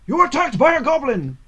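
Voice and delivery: high, loud dramatic announcement; narrator voice. Shown as a demonstration. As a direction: boom it out like a storybook narrator. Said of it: "it's the narrator's voice".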